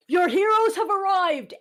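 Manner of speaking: a hint of grand herald; heroic announcement. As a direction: lightly proclaim the heroes' arrival.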